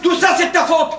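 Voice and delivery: high-pitched indignant blame; angry accusation. Acted. Brother blaming his brother for it all, angry accusation.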